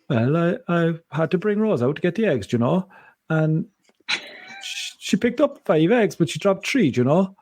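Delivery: put-on high, sing-song Cork accent; lilting Cork mum, pitched well up. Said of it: "if Denise's mom is talking, she'd be like".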